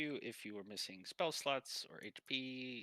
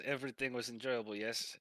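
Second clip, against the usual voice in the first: put-on questioning Russian accent; Russian guy. Russian masseur checks on his clients, accented. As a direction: ask politely with a Russian accent. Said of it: "so the Russian guy comes in".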